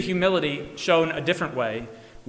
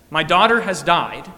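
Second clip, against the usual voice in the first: louder, firm, certain statement; confident declaration; 8 dB louder than usual. Retold or imitated. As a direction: say it with full confidence. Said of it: "Not a hint of doubt".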